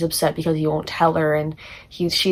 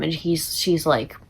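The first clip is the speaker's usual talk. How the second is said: usual speaking voice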